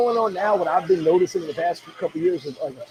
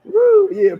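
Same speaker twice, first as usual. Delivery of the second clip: high, loud laughing whoop; 9 dB louder than usual. Real, not acted.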